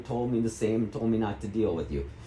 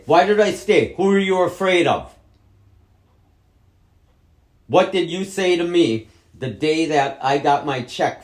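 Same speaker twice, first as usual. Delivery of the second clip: loud, high accusing shout; angry shout; 6 semitones higher, 8 dB louder than usual. Real, not acted. Son shouting accusations at his mother. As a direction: hurl the questions at her.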